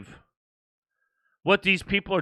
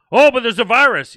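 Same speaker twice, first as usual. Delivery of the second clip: loud, sneering mock voice, pitched up; sarcastic mock objection; 4 semitones higher, 7 dB louder than usual. Host mocking virus believers, loud and sneering. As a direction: scoff it out like a fake excuse.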